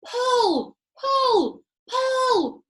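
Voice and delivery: loud, high yell. A narrator's reading. Monkey father yelling at the pullers, loud high yell. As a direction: yell the command. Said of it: "yelled Muggle Rump".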